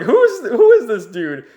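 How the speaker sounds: high-pitched incredulous question; hammed-up baffled sidekick voice; mock bafflement. Pino baffled by the newcomer.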